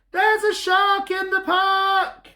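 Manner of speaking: put-on high, slow childish cry; boy crying out. Timothy crying out about a shark. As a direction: squeal it out like a scared little boy. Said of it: "In his loudest voice, Timothy cries".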